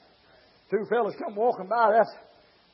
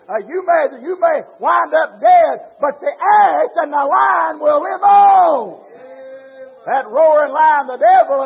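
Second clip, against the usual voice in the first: shouted sermon, building higher and louder; fiery preaching; 5 semitones higher, 11 dB louder than usual. Acted. Preacher thundering at his congregation, full-force preaching.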